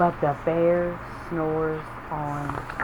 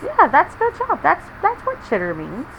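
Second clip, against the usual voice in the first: ordinary delivery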